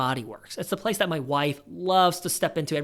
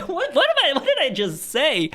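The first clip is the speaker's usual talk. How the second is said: high-pitched, laughing at himself; squeaky what-did-I-just-say; amused disbelief; 9 semitones higher than usual. Real, not acted. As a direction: catch yourself mid-joke, giggling. Laughing at his own joke.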